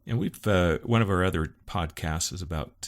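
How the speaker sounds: usual speaking voice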